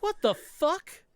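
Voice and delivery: high, drawn-out slow amazement; stunned disbelief. Retold or imitated. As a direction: stretch it out in disbelief. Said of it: "And I was like".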